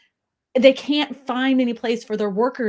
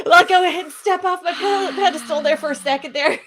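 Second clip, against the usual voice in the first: laughing, higher and louder; 8 semitones higher, 5 dB louder than usual. Real, not acted. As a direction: step down sheepishly through laughter. Laughing apology after her own rant.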